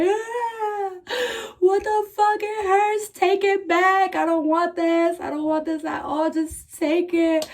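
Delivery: high, wailing sobs through the words; crying uncontrollably. Retold or imitated. Patient wails in pain after surgery, sobbing uncontrollably. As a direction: sob and beg them to take it back. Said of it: "I start crying, like, uncontrollably".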